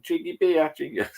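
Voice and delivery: put-on playful grandad voice for a two-year-old; coaxing toddler talk. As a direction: teach the grandson a funny phrase. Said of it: "I went".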